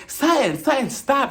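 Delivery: high-pitched imitated plea to stop; urgent intervention. Kyle jumps up to stop Sutton, urgent high interruption. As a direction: cut someone off, pitched well up.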